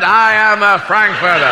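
loud, high, slow mock oratory; President Kennedy. Touring president proclaims himself in Frankfurt, loud mock oratory. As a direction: proclaim it grandly to a crowd. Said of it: "Then on to Frankfurt".

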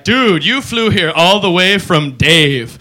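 very slow, drawn-out dude talk; explaining to an alien he came from Dave, slowly. Retold or imitated. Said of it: "We're gonna be like".

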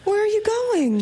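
high, startled demand; alarmed. Acted. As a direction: call after the one who is leaving. Wife calls after departing husband.

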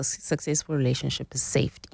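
usual speaking voice

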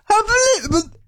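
high, strained lost-voice croak; imitating Harry Kane. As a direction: push a wrecked voice up high. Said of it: "trying to imitate Harry Kane".

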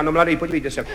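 low, quiet condescension; contemptuous. Retold or imitated. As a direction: imitate an older hand talking down to a youngster. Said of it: "se na mě tak pohrdavě podíval". (pohrdavě, hluboko a tiše)

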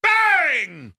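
sportscaster shout; big broadcast BANG call; Mike Breen bang. Retold or imitated. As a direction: belt it like a play-by-play man. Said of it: "I'd like to yell bang like Mike Breen".

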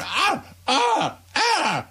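hammed-up high, soft injured moans; mock a player writhing on the grass; mock pained wails. Mocking a soccer player writhing.

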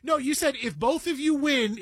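ordinary talk

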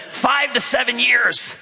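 high, drawn-out enthusiastic quote. Retold or imitated. Sales associate answers how long a guinea pig lives, enthusiastic. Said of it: "with enthusiasm, she said".

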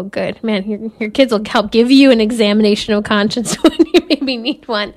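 ordinary talk

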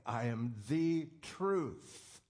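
"the" in "I am the truth" pressed, slow and deliberate; slow down and lean on "the"; stress on THE. Shown as a demonstration. Preacher to believers, stressing "the" in the truth, slowly. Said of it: "that accentuates the statement".

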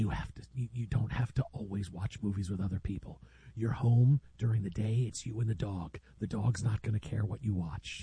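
low, very soft murmur; secretive near-whisper; hushed podcast voice. Pretend. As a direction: keep it down as if whispering.

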